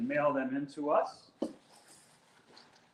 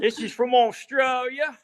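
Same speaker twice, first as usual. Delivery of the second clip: hammed-up Australian accent, high-pitched; 9 semitones higher, 4 dB louder than usual. Host announcing a box from Australia to co-host, playful Australian accent. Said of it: "you guys like my accent".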